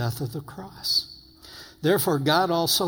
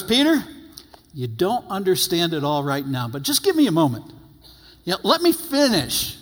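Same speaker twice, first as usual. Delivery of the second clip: higher, gentle voice of a patient Lord; patient Jesus; 6 semitones higher than usual. Retold or imitated. Jesus gently asking Peter to wait, patient and higher. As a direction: coax him kindly to wait. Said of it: "I love the Lord's patience. He goes".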